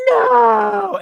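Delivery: shrieked high, loud no; hammed-up panicked shriek; mock scream. Imitating Fern screaming at the crash.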